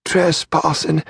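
a slow, strained word squeezed past a crushed throat; forced out gasping. A narrator's reading. Dying murderer forcing out a slow strained word for Hearn. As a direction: choke the word out. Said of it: "then forced out a word".

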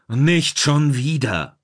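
slow, drawn-out cry of exasperation; angry bellow. A narrator's reading. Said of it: "brüllte Schablonski seinen Zorn heraus".

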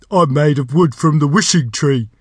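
loud, rambling door creature; chatty magic door. A narrator's reading. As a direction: prattle on defensively as a talking door.

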